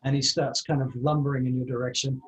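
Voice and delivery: ordinary delivery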